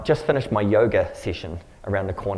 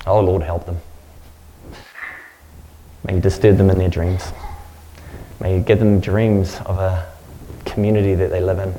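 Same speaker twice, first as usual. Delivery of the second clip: earnest, lower prayer voice, unhurried; 5 semitones lower than usual. Real, not acted. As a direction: pray over them with steady conviction. Pastor prays over congregation.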